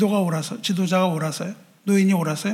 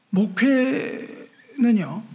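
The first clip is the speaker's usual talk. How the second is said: drawn out; much slower than usual. Acted. Preacher draws out key word.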